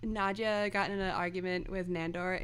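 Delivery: usual speaking voice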